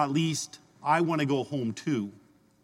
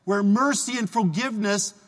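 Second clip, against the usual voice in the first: raised, slow, earnest appeal in Jesus' voice; fervent preaching; 4 semitones higher than usual. Acted. Priest urging the congregation to trust God, fervent slow preaching. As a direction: lift each trust in line slowly.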